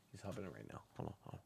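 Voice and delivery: hushed unvoiced aside; whisper. Real, not acted. Host whispers while waiting for Jeff to rejoin. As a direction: whisper it as if not to disturb.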